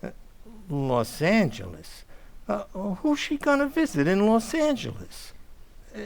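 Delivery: normal talking voice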